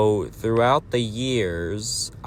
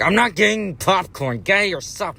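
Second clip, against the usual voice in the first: much higher, mocking angry put-on voice; angry refusal; 9 semitones higher than usual. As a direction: snap it high and indignant. Usher refusing the dad.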